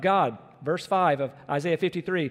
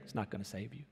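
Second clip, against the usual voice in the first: quiet; 9 semitones lower, 16 dB quieter, faster than usual. Real, not acted. Refrain dropping away.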